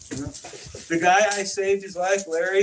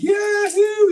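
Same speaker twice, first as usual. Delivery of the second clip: much higher, loud gleeful yahoo; joyful whoop; 7 dB louder than usual. Retold or imitated. Larry flying free in the dream, a loud joyous whoop. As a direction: whoop like you've just been set free. Larry freed, flung into air.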